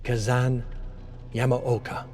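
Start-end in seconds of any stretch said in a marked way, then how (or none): none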